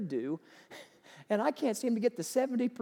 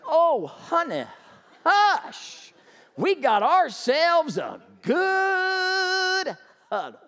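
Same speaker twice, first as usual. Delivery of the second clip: higher, louder, slow hammed-up raving; mock ecstatic worshipper; 5 semitones higher, 3 dB louder, much slower than usual. Preacher mocking churchgoers raving about lights and music, hammed-up ecstasy. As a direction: gush like you've found heaven in a huddle.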